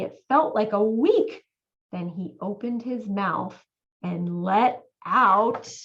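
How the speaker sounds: ordinary delivery, nothing marked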